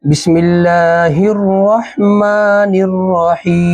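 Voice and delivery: slow chanted ta'awwudh and basmala; Quranic recitation. Acted. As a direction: intone it like a reciter opening the lesson. Opens the lesson with recitation.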